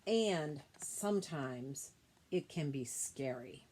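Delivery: gentle, measured read-aloud voice; storybook reading voice; reading to children. Acted. Reads picture book to class.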